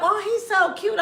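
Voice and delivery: high, laughing mock coo; mock gushing mom. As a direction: gush over him, half laughing. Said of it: "I wanna put up".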